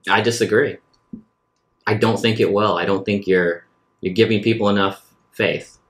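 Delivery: ordinary talk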